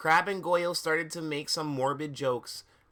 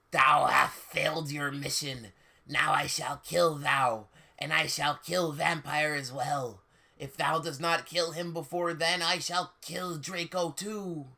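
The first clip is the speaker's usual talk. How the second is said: evil raspy villain. Acted. Darth Vader threatening to kill, evil raspy voice. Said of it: "said evilly in his raspy voice".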